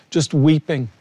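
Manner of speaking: usual speaking voice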